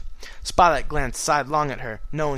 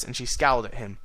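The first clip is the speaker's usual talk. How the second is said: ordinary delivery, nothing marked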